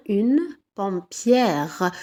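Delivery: "pompière" slow with an open "-ière"; teacher modelling the feminine ending; slow teaching demo. Shown as a demonstration. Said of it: "au féminin devient".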